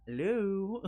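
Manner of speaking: a lightly voiced high sing-song call; sing-song hello. Strange man calling from inside the tent, sing-song. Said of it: "A sing-song voice calls out".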